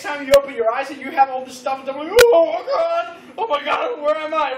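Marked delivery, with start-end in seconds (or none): 2.1-4.5 loud, high mock panic; comic high-pitched alarmed cry; freaked-out waking. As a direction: wake up hammed-up and panicking. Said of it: "He must have freaked out".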